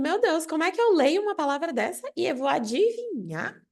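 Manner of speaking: high-pitched puzzled protest; bewildered learner. Acted. Teacher voices a student wondering about reading, high bewildered questioning. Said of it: "você pode ficar se perguntando".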